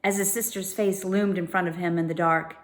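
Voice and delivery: usual speaking voice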